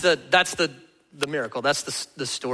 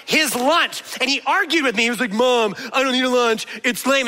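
imitating indignant mom; 7 semitones higher than usual. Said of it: "she's like".

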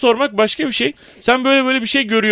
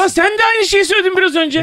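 high, indignant comeback; indignant retort; 9 semitones higher than usual. Real, not acted. Panelist accused of showboating hits back, indignant. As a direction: throw the accusation back, pitched up. Panel quarrel over who said what.

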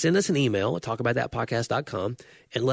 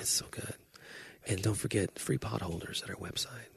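unvoiced mock radio whisper; 11 dB quieter than usual.

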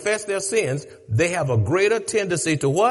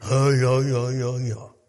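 low muttered mock grumble; a touch of sulky muttering; mock grumbler; 6 semitones lower than usual. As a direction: imitate someone grumbling under their breath. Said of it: "You grumbling in your thought".

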